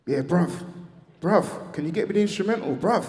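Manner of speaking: excited late-night call; urgent request. Retold or imitated. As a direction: beg for the instrumental in a rush. Rich calls back urgently.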